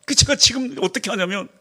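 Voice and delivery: ordinary talk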